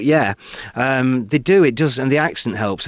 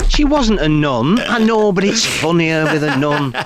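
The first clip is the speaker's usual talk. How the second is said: high, loud comic protest; mock bickering; 4 semitones higher, 4 dB louder, slower than usual. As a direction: argue the point in a raised, drawn-out voice. Acted argument over exaggerated story.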